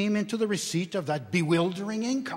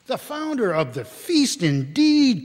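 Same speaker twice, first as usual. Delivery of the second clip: higher, louder indignant outburst; indignant cry; 6 semitones higher, 7 dB louder than usual. Acted. Wife scoffs at husband's toast to his stingy employer, indignant and loud. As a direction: fling the words back at him, offended. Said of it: "cried Missus Cratchit, reddening".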